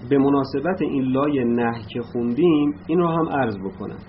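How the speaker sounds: ordinary talk, nothing marked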